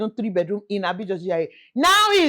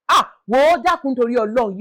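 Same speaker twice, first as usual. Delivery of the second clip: usual speaking voice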